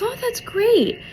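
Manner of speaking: high, encouraging mom voice; retold mom. Mom praises her little girl, imitated high encouragement. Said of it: "the mom goes".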